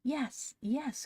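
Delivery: put-on high, eager child's cry; delighted. A narrator's reading. Phronsie is at last understood, delighted. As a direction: pipe up in delight. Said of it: "perfectly delighted at being at last understood".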